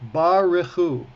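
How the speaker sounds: Baruchu in three full syllables, ba-re-CHU; careful three-syllable pronunciation. Shown as a demonstration. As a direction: say Baruchu clearly, keeping the middle syllable. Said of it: "Everybody hear that".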